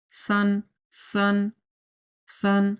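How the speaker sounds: slow "সান" with a lightly hissed s; light air on "সান". Shown as a demonstration. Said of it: "হালকা পরিমাণ বাতাস বের হয়ে যাচ্ছে". (হালকা বাতাসে "সান")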